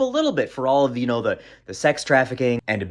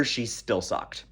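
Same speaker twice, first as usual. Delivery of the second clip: deadpan punchline; 4 semitones lower, 10 dB quieter, much slower than usual. Real, not acted. Punchline about losing again.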